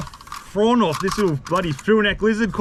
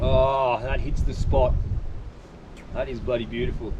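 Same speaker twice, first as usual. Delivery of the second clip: low, soft, content after a sip; satisfied sigh; 9 semitones lower, 5 dB quieter than usual. Real, not acted. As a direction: savour the first sip. First sip of coffee.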